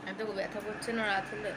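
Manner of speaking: talking through clenched teeth from toothache, soft and careful; quiet pained words pushed through tight teeth. Real, not acted. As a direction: keep the jaw shut and speak gently, it hurts. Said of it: "দাঁত চেপে চেপে কথা বলছি".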